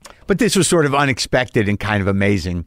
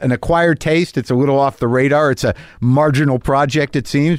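ordinary talk

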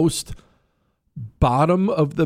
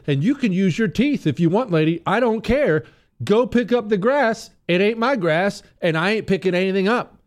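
high, emphatic mock tirade; defiant; 8 semitones higher than usual. Homeowner telling off the woman on the phone, high defiant tirade. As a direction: fire off a final indignant refusal.

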